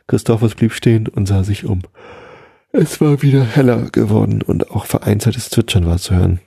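ordinary delivery, nothing marked

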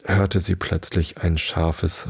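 ordinary delivery, nothing marked

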